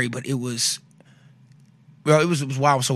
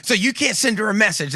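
mock indignant; 7 semitones higher than usual. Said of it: "I was already cussing at doctors".

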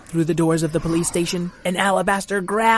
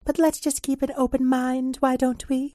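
high fairy voice; 7 semitones higher than usual. Acted. Fairy answers sceptic.

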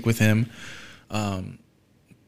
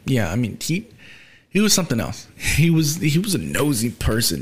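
usual speaking voice

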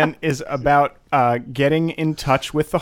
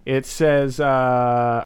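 usual speaking voice

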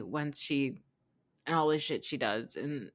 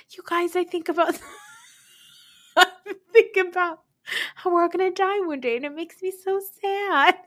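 much higher, odd sing-song mock of a sad woman; mock Vicky voice; 13 semitones higher, 6 dB louder than usual. Vicky in the woods telling the girls about death, high mock-sad voice. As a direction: ham up a tearful woman brooding on death, pitched way up. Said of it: "In her, like, weird voice".

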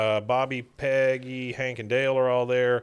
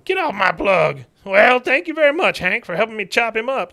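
put-on higher, louder sarcastic old man; mock furious codger; 10 semitones higher, 6 dB louder than usual. Cotton blaming Hank for the lost car, mock angry codger. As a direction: snap at your son like a bitter veteran.

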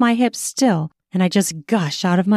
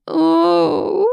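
moaning gasp; 10 semitones higher than usual. A narrator's reading. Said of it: "filled with a low groan".

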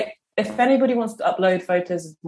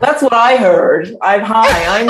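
put-on American accent; 4 semitones higher, 6 dB louder, slower than usual. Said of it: "I'm American".

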